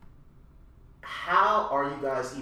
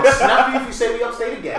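laughing tease; 9 semitones higher, 7 dB louder, faster than usual. Real, not acted. Teasing the upstate mix-up.

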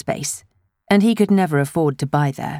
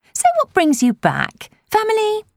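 nosy busybody. A narrator's reading. Elaine pries.

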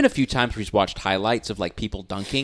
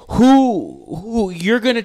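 excited student; 9 semitones higher, 5 dB louder, much slower than usual. Retold or imitated. Student cuts in mid-lesson.

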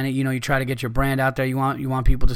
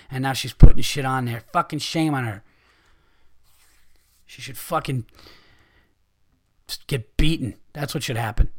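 ordinary talk, nothing marked

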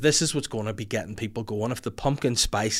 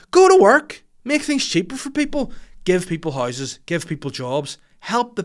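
much higher and louder, yelled at full force; furious shout; 6 semitones higher, 6 dB louder than usual. Real, not acted. Ranting at Stormont politicians, a furious yelled demand. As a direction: bellow the order at the politicians.

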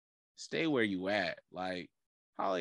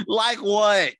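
high, loud mock disbelief, laughing; incredulous again; 12 semitones higher, 10 dB louder than usual. Real, not acted. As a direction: repeat it louder in disbelief. Repeated with laugh, higher.

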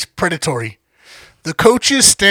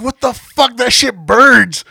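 higher, louder, slow pained outcry; retold pained yell; 7 semitones higher, 8 dB louder, slower than usual. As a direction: drag it out like the shot is burning your throat. Quoting himself after Everclear shot.

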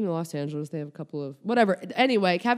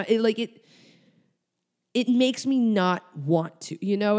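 high, louder and slow exasperation; worked-up rant; 6 semitones higher, 5 dB louder, slower than usual. Real, not acted. Comic ranting to the audience, higher and louder. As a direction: wind yourself up about being forced. Ranting about forced gratitude.

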